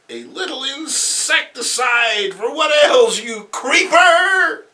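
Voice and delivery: very high slow shouted taunt; high shrieking taunt; shrieking villainess. Acted. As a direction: scream the insult up at him. Felicity throws acid.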